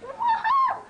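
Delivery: loud, high whoop of joy; excited cheer. Real, not acted. Kid cheering for the camera, loud high whoop. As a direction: let out a big happy whoop.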